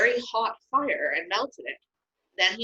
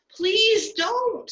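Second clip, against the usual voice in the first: much higher and louder, begging; pleading; 4 dB louder than usual. Acted. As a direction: Moses begging God to spare the people, pleading. Moses begs God for mercy.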